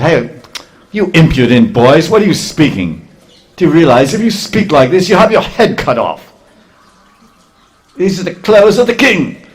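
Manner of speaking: high, loud furious washerman; angry shout. Acted. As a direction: bark the threat down at the boys. Washerman threatens Krishna and Balaram.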